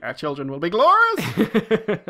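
slow, loud, high booming vow; grand proclamation. Retold or imitated. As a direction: declare it grandly, laughing. Voicing smitten character.

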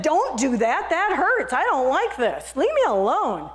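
hurting teacup pleading; teacup protesting. Acted. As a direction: beg him to stop it. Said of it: "I said".

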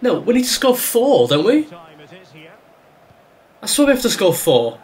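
high-pitched, incredulous protest; exasperated disbelief. Real, not acted. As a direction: protest as if the task is impossible. Disbelief at needing four goals.

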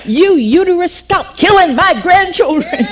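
high, loud shout at the womb; angry command. Retold or imitated. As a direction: yell it at the womb, slowing on the last words. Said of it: "I was angry".

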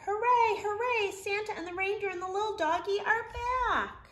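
elves cheering. Acted. Elves welcome Santa home, cheering high and loud. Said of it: "All the elves sang out a cheer".

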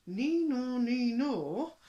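high, loud two-tone siren chant; police siren imitation. A narrator's reading. Police racing to the house, imitated siren. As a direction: sing out a siren, swinging between two notes.

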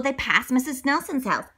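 plain reading voice

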